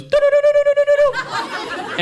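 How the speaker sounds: very high rapid warbled do-do-do; mock the man's hand-in-beer warble; high gargling do-do-do. Comic doing a stranger's high beer-splashing warble. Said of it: "audibly goes".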